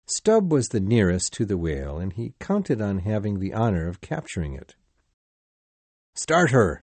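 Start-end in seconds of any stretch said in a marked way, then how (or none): 6.2-6.8 loud rallying command to the rowers; urging his rowers on with a loud shout; shouted order. Acted. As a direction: bellow it across the boat. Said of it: "he ordered".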